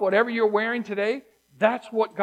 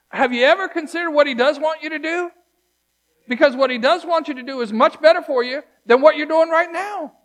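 higher, louder preaching climax; preaching at full force; 7 semitones higher, 5 dB louder than usual. Acted. Preacher urging the church to put on God's ways, full-force preaching. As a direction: drive it home to the congregation.

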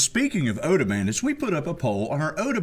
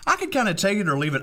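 ordinary talk

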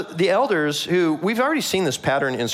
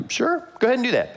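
cocky; 10 semitones higher, faster than usual. Retold or imitated. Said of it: "so cocky".

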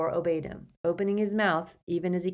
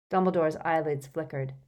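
plain reading voice

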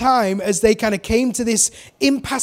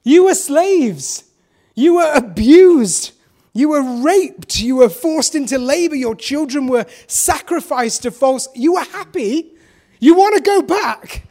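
exasperated Moses; 5 semitones higher, 6 dB louder than usual. Retold or imitated. Moses scolding the grumbling Israelites, incredulous. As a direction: spell out the absurdity in disbelief. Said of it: "And he's like".

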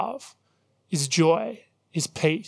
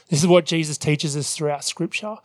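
ordinary talk, nothing marked

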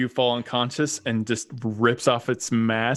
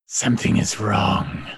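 gravelly dragon voice; 3 semitones lower than usual. Acted. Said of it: "You hear the gravelly voice come from Flamio".

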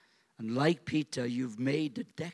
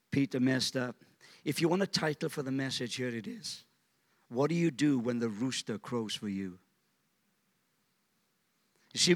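ordinary talk, nothing marked

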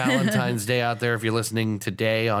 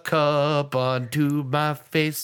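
Jazzy Moo voice; 7 semitones higher than usual. Retold or imitated. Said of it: "the Jazzy Moo".

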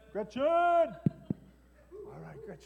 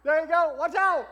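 excited calling; 8 semitones higher, 12 dB louder than usual. Acted. Mock football play.

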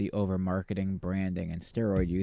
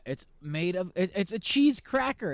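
ordinary delivery

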